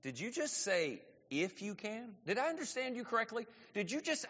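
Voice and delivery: soft, stunned disbelief; hushed incredulity with pauses; incredulous pause. Acted. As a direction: quietly question what you just heard. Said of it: "in great pause and emotion and saying".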